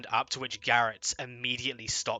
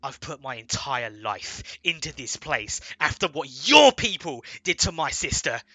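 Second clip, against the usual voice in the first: furious shout; 5 semitones higher, 13 dB louder than usual. Acted. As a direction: bellow your rage at the warden. Elias shouting at warden.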